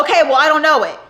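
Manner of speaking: fed-up driver shouting back; irritated yelled dismissal; angry retort. Retold or imitated. Uber driver snaps at rider.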